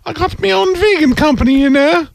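high, put-on posh English lady. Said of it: "fantastic Lady English lady".

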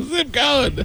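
laughing high-pitched imitation; mock scream. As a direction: mimic the screamed demand in a squeal. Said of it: "he's screaming at the guy".

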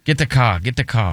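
"Get the car" with a lightly put-on Boston accent, no r in "car"; imitating a Boston local, only lightly; mock Boston accent. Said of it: "don't they say car or something?".

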